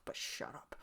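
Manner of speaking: teasing whisper. Real, not acted. Reviewer whispering a loving jab at the singer. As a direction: hush it out as a fond tease.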